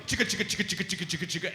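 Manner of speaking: rapid chopping rotor imitation; helicopter noise. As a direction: imitate whirring helicopter blades with the mouth. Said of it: "And goes".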